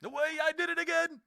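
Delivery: real high, loud outburst; exasperated shout. Real, not acted. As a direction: cry out at your own blunder. Hit space bar by mistake.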